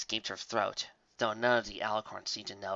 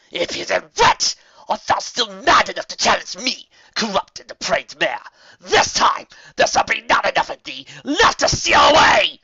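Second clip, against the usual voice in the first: loud, high archaic challenge; enraged queen shouting; furious regal threat; 11 semitones higher, 14 dB louder than usual. A narrator's reading. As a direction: roar your threat at your sister.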